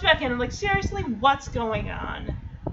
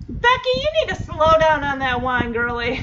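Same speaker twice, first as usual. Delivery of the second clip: mock scolding; 3 semitones higher, 7 dB louder than usual. Mock scolding a show character.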